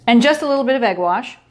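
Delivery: normal talking voice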